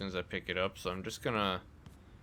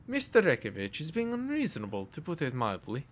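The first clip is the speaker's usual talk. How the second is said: a higher female nurse voice with a lightly Russian tinge; attempted female voice; 5 semitones higher, 3 dB louder than usual. Shown as a demonstration. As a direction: play the nurse in a higher woman's voice. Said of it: "I can't do a female voice".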